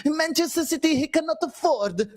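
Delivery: a hint of Borat, mocking the manager; Borat impression. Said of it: "this fucking Borat Sakadiv".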